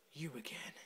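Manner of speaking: hushed threat under the breath; creepy whispered greeting; menacing whisper. Real, not acted. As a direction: whisper it like a stalker. Menacing whisper at rival player.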